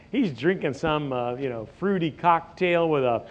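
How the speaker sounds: usual speaking voice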